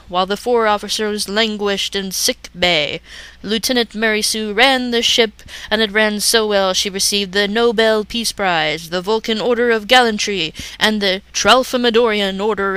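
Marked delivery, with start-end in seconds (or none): none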